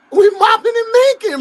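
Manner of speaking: gleeful loud repeat; excited shout. Real, not acted. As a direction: hype it up, shout it. Repeats phrase, louder and higher.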